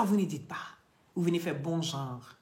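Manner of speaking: ordinary delivery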